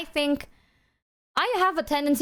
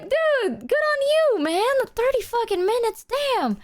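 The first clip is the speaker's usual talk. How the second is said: mock cheering; 13 semitones higher, slower than usual. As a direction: cheer him on, way up. Cheering the friend on.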